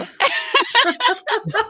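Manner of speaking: a burst of high laughter. Real, not acted. Laughing at her mom's rooster call, high burst of laughter. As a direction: laugh hard at the joke.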